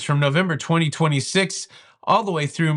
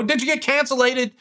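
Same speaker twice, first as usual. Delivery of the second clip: higher, teasing the made-up word; playful mock word; 9 semitones higher than usual. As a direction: tease the viewers with a silly word. Playful question to viewers.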